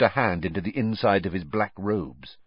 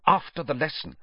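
higher, terse snap; Snape, snapping; 7 semitones higher than usual. A narrator's reading. Snape refusing Karkaroff, snapped. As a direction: snap it to shut him down. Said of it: "Snape snapped".

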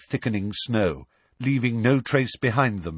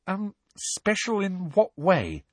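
slow, high hesitant question; tentative; 11 semitones higher, slower than usual. A narrator's reading. Hermione probing Hagrid's plans, slow and tentative. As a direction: ask warily, fearing the answer. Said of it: "asked Hermione tentatively".

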